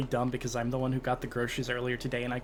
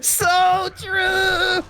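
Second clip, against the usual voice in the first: much higher, louder gleeful cry; delighted shriek; 8 dB louder than usual. Real, not acted. Co-host shrieks gleeful agreement before laughing. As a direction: cheer it out, cracking up.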